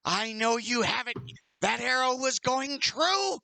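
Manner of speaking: high, insistent archer's cry; yelled accusation. Acted. Archer threatening the player over his duck, high yell. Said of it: "he yells".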